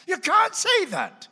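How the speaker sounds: high, loud mock outrage; outraged exclaim. Real, not acted.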